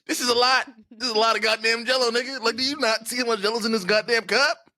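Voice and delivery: acted exasperation, pushed up high; exasperated complaint. Acted complaint about too much Jell-O.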